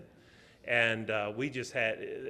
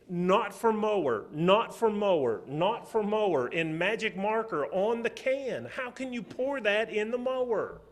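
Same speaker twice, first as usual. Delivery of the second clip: higher, louder furious scolding; angry dad; 9 semitones higher, 6 dB louder than usual. Retold or imitated. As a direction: blow up at your son over the mower. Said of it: "man, I was mad".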